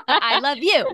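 high, loud lovey-dovey giggle; giggly late night call. Pretend. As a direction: gush like a smitten teen on the phone. Mock lovers' phone goodbye.

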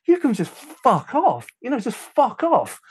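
high-pitched, exasperated retold outburst; losing it. Engineer loses it with the grabbing man, high exasperated outburst. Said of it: "I just lost it".

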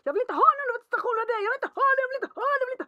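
very high, loud, fast mock tantrum of a child. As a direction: scream it like a kid in a meltdown. Son's tantrum about present imitated. (härmat barnutbrott, mycket högt och snabbt)

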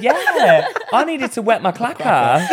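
high, loud, laughing through the words. Real, not acted. Joking with friends about being thirsty. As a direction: giggle through the joke.

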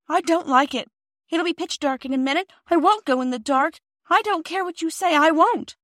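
put-on high, loud frightened child; a scared little girl refusing; scared refusal. A narrator's reading. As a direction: dig in your heels, frightened. Phyllis refuses in the dark.

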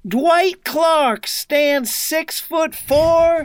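slow, high broadcaster's call; quoting the announcer's line in his voice; imitating a sportscaster. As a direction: stretch it out like a legendary commentator. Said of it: "he says".

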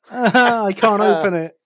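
high, slow, strained laughing words; laughing while straining. Real, not acted. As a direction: laugh through the effort of the lid.

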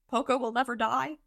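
high, unsure question; acted high, doubtful question; hesitant repeat. A narrator's reading. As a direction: repeat it timidly, rising at the end. Butters hesitantly repeats the slogan.

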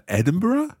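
squeaky high "Edinburgh?"; a silly high-voiced "Edinburgh?"; mock high. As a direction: pitch "Edinburgh?" way up playfully. Playful question, voice jumps.

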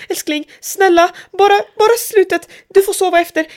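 high, insistent begging voice; imitating a panicked partner; panicked pleading. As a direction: plead in a panic, pitched well up. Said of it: "Kassen fick panik".

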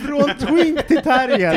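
high, slow announcer reading of a book title; mock trailer voice, drawn out; grand book title. Shown as a demonstration. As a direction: announce it like a bestseller's name. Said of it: "En bok, en bok av".

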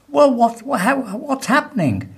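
very high, stammering bewilderment; flustered. Acted. Baffled prisoner to calm companion, acted fluster. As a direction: fumble for the question, pitched high.

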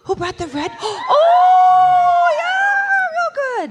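high, loud squeal of joy; excited shout. Real, not acted. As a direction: shriek with delight at the crowd. Excited shout at hockey fan.

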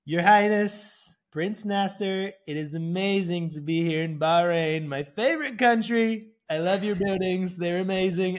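milk each word like a showman Trump; Donald Trump impression. [name] doing Trump for the prince of Bahrain, slow hammed-up Trump. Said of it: "doing Trump impressions to the prince".

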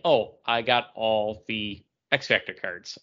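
ordinary talk, nothing marked

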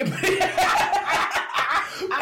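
high, loud laugh; big laugh. Real, not acted. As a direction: burst out laughing.

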